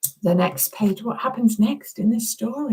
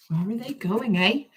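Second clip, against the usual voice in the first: usual speaking voice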